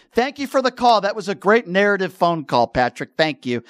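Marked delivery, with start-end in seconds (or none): none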